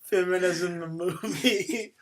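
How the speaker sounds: giggling through the words; laughing. Real, not acted. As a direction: say it while laughing. Laughing while repeating title.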